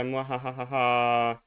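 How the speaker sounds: slow hammed-up evil laugh; mad scientist cackle. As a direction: cackle like a cartoon villain. Villain laugh after conquest line.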